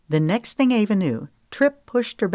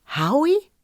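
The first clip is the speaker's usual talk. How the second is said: much higher, startled; shocked; 11 semitones higher, 3 dB louder than usual. Acted. Woman stunned by news of theft, much higher. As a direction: gasp out the name.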